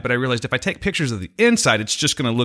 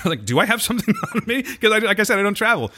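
laughing; 10 semitones higher than usual. Real, not acted. Laughing about getting frisked.

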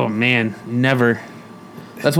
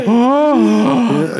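high gasped "oh"; drawn-out, high intake of shock; 8 semitones higher, 4 dB louder than usual. Retold or imitated. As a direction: act out stunned horror. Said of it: "Like, I was in shock".

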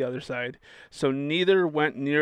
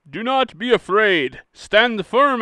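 louder, higher commanding read; commanding Moses; 7 semitones higher, 6 dB louder, slower than usual. Acted. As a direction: proclaim it like a leader rallying people. Reading Moses' command aloud.

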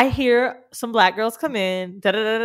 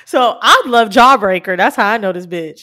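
normal talking voice

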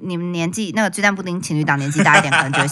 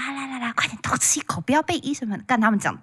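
softer, half-whispered coaxing; conspiratorial hush; 5 semitones higher, 7 dB quieter than usual. Retold or imitated. An old husband urging his wife to sneak a bite, hushed. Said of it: "然后她说".

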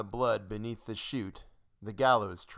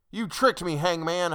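high, loud accusation; raised, outraged protest; shouting; 8 semitones higher, 6 dB louder than usual. A narrator's reading. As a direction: shout it as one betrayed. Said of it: "I shouted then".

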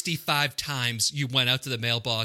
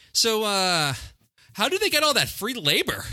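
mock clueless tourist; 7 semitones higher than usual. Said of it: "He's like".

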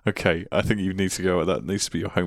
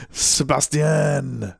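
Sebastian drawn out very slowly in a hammed-up French accent, sə-bas-ti-an, falling; ridiculous French accent; 8 semitones higher, 3 dB louder, much slower than usual. Co-host plays along, saying Sebastian in a silly French accent. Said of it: "with my ridiculous French accent".

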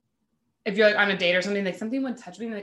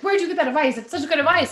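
higher and louder, impressed and eager; amazed friend; 8 semitones higher, 7 dB louder, faster than usual. Retold or imitated. Friend calls, amazed by your advice, excited and loud. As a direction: gush over the friend's great advice.

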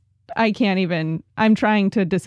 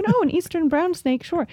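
mock casual acceptance; 7 semitones higher than usual. Mocks the harmless-sounding snake name.

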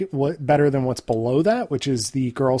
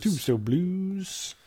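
higher, slow, softly drawn-out echo; playful echo; 5 semitones higher, 5 dB quieter, much slower than usual. Pretend. Echoing the co-host's title, playful high drawl. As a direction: stretch the title out high and soft.

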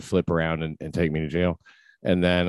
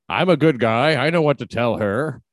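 loud, high mock macho boast; mock tough guy; 5 semitones higher, 4 dB louder than usual. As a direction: ham up the proud husband. Mocking quoted man.

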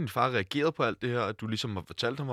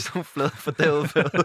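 mock disgust; 5 semitones higher, 6 dB louder, much slower than usual. Playful joke on flat beer.

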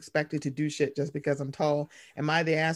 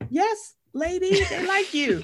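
teasing excited reply; 14 semitones higher, 4 dB louder, slower than usual. Retold or imitated. Replying to her mother in the story.

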